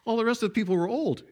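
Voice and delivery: normal talking voice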